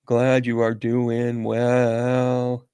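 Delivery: very slow, stretched on a level pitch; drawn out. Real, not acted. Answering a viewer's check-in, slowly drawn out. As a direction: hold every word long and even.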